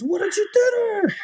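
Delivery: very high mock-outraged demand; accusing. Nurse to the patient's brother, accusing. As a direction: imitate a shocked nurse, voice shooting up. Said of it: "are having this argument".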